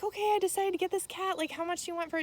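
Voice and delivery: high, fast, chirpy roommate voice; light imitation of an eager roommate; breezy roommate. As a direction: rattle it off high and quick like a keen buyer. Roommate quoted deciding to buy.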